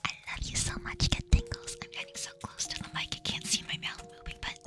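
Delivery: close-mic whispered ASMR, soft and tender. Acted. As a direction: murmur tingles right into the mic.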